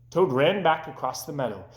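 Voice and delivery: ordinary delivery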